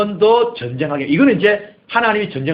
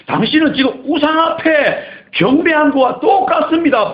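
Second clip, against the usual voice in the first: much higher, louder accusation; accusing cry; 10 semitones higher, 5 dB louder than usual. Acted. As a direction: cry out the prophet's charge. Samuel accusing Saul.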